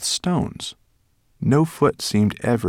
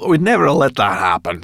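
brisk Sorrel; 7 semitones higher, 8 dB louder than usual. A narrator's reading. Sorrel speaks with mouth full.

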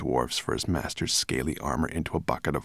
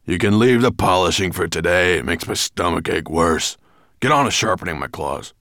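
loud, gruff dragon orders; bellowed; 8 dB louder than usual. A narrator's reading. Dragon with a stomach ache ordering his servant, bellowing. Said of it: "bellowed Nettlebrand".

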